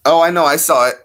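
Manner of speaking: suddenly remembering, thrilled; eager outburst of recognition; excited. Real, not acted.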